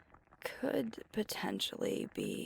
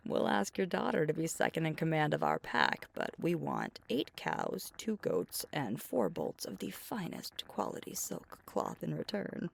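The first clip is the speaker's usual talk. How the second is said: hammed-up formal dowry demand, louder; mock royal proposal; 3 semitones higher, 4 dB louder than usual. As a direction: proclaim it like a joking negotiator at court.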